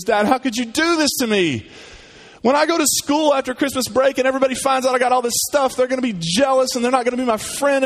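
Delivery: put-on high, whiny complaining child; whiny kid. Pessimistic son complaining to dad, high and whiny. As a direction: wail like a spoiled kid. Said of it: "the pessimistic kid goes".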